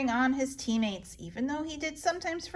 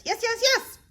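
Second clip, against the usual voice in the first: high, loud eager yes; eager insistence; 10 semitones higher, 4 dB louder than usual. Acted. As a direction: beg like a child. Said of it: "I say".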